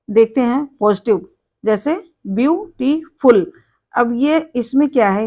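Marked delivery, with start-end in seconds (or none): 2.3-3.5 "beautiful" broken into three separate syllables. Shown as a demonstration. Teacher introducing the example "beautiful", split into syllables. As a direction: say "beautiful" in pieces, pausing between syllables. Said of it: "इसमें तीन syllable है".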